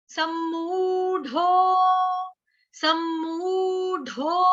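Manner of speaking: slow, "सम्मूढो" with a long ऊ and breathy ढ; aspirated ढो. Shown as a demonstration. Teacher models the ढ sound slowly for her class. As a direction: stretch the ऊ and breathe the ढ. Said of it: "इसको ढो नहीं कहना".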